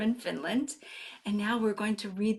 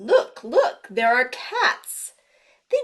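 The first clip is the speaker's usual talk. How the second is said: excited; 7 dB louder than usual. Acted. Showing the children the cats, excited. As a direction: point it out with glee.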